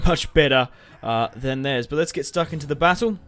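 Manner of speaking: ordinary talk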